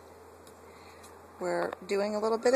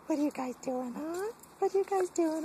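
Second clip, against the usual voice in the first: high dog-talk voice; 9 semitones higher than usual. Real, not acted. Talking to the dogs.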